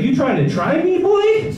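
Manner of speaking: squaring up to a bear with a laugh; mock tough challenge. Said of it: "I was like".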